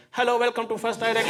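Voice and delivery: bright customer-service greeting; telephone voice. Shown as a demonstration. Showing his phone voice to the comedy crowd, politely. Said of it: "my telephone voice is".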